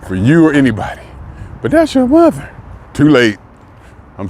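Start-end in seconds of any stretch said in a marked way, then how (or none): 1.6-2.5 very high mock-objecting voice; mimicked protest. Relatives object to his lack of feeling, mock high-pitched protest.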